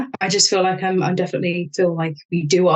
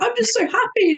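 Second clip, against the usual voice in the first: squeaky high, giddy on the edge of a laugh; high-pitched, manic delight; mock manic joy. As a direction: sell it like you're laughing uncontrollably. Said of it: "psychotically laughing".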